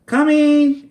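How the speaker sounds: high loud shout. Acted. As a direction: shout back to dad. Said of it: "Annie shouted".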